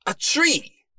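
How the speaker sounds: high, loud cry of discovery; startled exclamation. A narrator's reading. Detective spits out his tea at a discovery, startled. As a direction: burst out with sudden surprise. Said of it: "he exclaimed".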